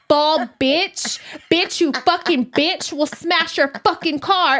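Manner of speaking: put-on high, slow child's voice shouting threats; kid voice. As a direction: mimic a little girl cussing. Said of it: "Peas is in the back".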